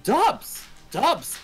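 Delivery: high-pitched, punchy echo; acted disbelief. Acting out his stunned reaction.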